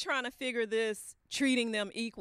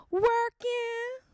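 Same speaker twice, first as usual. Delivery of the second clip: high, louder coy reply; coy high wife; 13 semitones higher, 6 dB louder than usual. Retold or imitated. Wife teasing husband on the phone, high and coy. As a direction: purr it back playfully.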